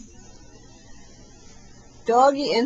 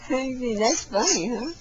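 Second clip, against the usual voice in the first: ordinary talk, nothing marked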